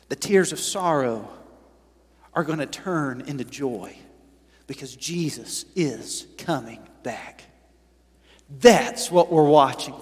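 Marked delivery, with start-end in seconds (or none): 0.1-7.4 softer, lower consolation; tender promise. Real, not acted. Pastor to hurting listeners, soft and tender. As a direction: comfort the grieving gently.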